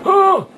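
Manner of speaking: sharp high shocked yelp; sudden high gasp of fright; startled gasp. Real, not acted. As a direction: jump out of your skin with a loud cry. Startled by game monster.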